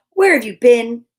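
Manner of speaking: loud scolding question; mom yelling. A narrator's reading. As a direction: demand an answer from the child. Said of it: "yelled Mom".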